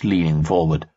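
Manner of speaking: ordinary delivery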